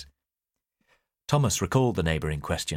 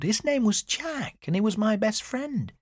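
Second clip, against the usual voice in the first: very high, drawn-out declaration; high-pitched, heartfelt insistence; emphatic daughter; slower than usual. A narrator's reading. Daughter insists on remembered friend.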